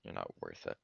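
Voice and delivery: very low, hushed dismissal; quiet dismissal. Acted. Backing off from hitting Cole, low and very soft. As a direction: drop right down, barely voiced.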